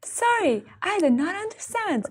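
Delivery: hammed-up cheerful machine reply; mock robot voice. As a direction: imitate a chirpy phone robot. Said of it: "the robot would be like".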